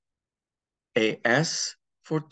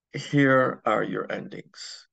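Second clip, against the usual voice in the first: normal talking voice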